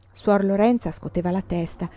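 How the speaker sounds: plain reading voice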